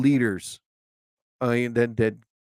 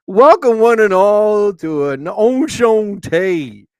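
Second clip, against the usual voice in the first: high, drawn-out hammy welcome; mock grand announcer; 6 semitones higher, 5 dB louder, much slower than usual. Host opening the show to listeners, mock grand announcer. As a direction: stretch it slowly, high and grand.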